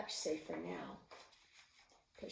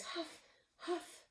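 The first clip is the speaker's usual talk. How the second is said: huffing; 9 semitones higher than usual. Acted. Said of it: "And she says".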